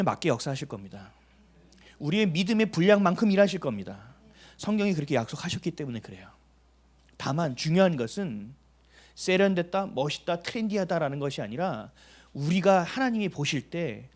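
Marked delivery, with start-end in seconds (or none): none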